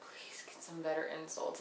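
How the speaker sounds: soft unvoiced whisper; hushed whispered eye-roll; whispered aside. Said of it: "I'm like".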